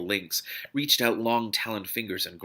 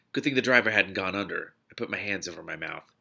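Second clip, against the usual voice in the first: ordinary delivery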